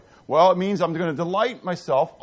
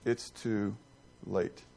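much softer and lower, dropped nearly to a murmur; quiet echo; 9 semitones lower, 12 dB quieter, much slower than usual. Shown as a demonstration. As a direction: let the verdict fall away quietly.

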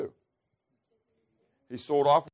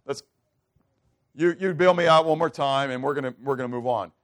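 flippant apology; 3 semitones higher, 7 dB louder than usual. Pretend. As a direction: toss off the apology like it's nothing. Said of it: "is not when we just say".

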